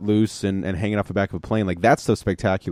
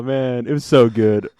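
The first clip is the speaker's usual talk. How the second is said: ordinary talk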